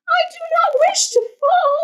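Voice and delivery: very high squeaky cry of alarm; panicked fish. Acted. Fish dangling in the air, squealing high. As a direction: shriek to be put down. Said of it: "said the fish".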